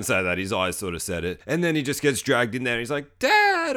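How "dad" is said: put-on high, loud child's scream; mock cry for dad. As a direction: yell out high like a panicking kid. Dragged-in kid cries out for dad.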